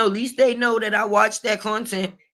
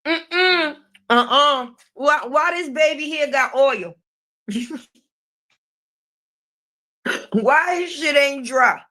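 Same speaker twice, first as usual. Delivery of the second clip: shocked disapproving auntie, pitched up; sassy disapproval; 5 semitones higher than usual. Retold or imitated. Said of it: "She said".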